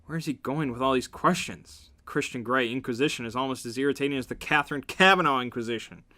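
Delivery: normal talking voice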